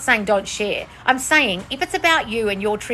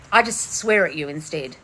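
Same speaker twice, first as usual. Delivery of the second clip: ordinary delivery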